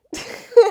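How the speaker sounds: high real laugh at own voice. Real, not acted. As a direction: burst out laughing. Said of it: "I really need to get a better voice".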